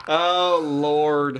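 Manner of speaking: usual speaking voice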